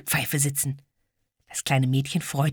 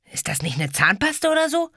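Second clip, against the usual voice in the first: put-on teasing boy; 12 semitones higher than usual. Ede teasing question.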